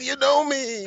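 high, amazed asking; mock amazement that anyone knows him; surprised question. The player asking the comedian, high and surprised.

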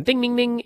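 high-pitched "ding, ding, ding"; imitated phone chime. Host mimics McIntyre's phone going off, high chiming dings. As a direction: chime it like a doorbell alert.

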